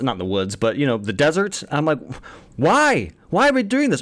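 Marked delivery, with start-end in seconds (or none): 2.6-4.0 exasperated. Retold or imitated. Mock exasperation at the scene.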